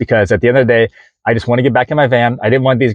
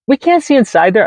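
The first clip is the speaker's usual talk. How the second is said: exasperated plea; 12 semitones higher than usual. Retold or imitated. Pleading with officer over van.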